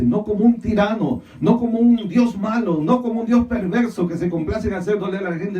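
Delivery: emphatic, insistent sermon delivery; impassioned preaching. Real, not acted. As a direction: drive each denial home from the pulpit. Preacher at full force.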